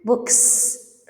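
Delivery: "books" with a long unvoiced S after K; S ending. Shown as a demonstration. Teacher gives example after unvoiced sounds, S on "books". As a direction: finish "books" on a clear hissed S. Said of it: "after unvoiced sounds".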